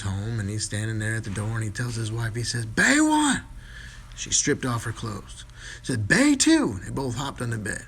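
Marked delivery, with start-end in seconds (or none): 2.7-3.4 high, loud bellow; calling out. Acted. Husband calling out command. 6.1-6.8 high, loud bellow; calling out. Acted. Husband calling out command.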